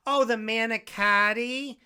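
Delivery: mock the waitress finally getting it. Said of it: "and she goes".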